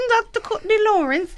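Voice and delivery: put-on over-the-top British accent while laughing friend listens. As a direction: grandly announce the name in a mock British accent. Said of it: "say it in a British accent".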